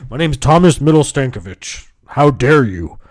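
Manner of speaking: hammed-up affronted protest; mock indignation. Customer repeating his name to the caller, loudly mock indignant.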